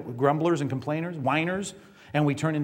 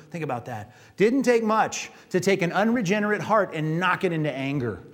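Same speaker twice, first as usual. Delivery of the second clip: ordinary talk, nothing marked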